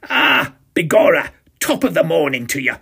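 hammed-up Irish hello, called out loud; cried out. A narrator's reading. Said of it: "I cried".

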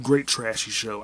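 normal talking voice

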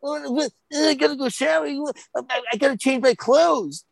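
high, whiny mock disgust; squeamish kid. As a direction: imitate a kid grossed out by mess. Imitating squeamish kids covered in egg.

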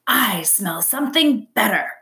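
a sly fox voice. A narrator's reading. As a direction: tease it as he trots off. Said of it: "he said as he trotted".

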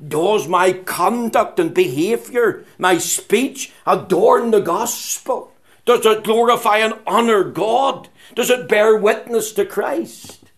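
preacher's rising exhortation; fervent preaching. Acted. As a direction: challenge the congregation, building in force. Preaches searching questions, building.

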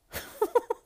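high, loud burst of laughter; real laugh. Real, not acted. Laughing at what they've been seeing lately, high and loud. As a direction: crack up in a high-pitched laugh.

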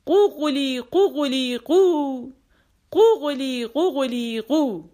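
a touch of a rooster's crow. A narrator's reading. Mulla imitates the rooster in his poem, lightly crowing.

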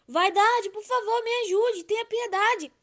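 high, loud begging; desperate plea. Acted. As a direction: beg for pity. Love begging Vanity for help.

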